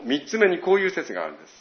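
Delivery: usual speaking voice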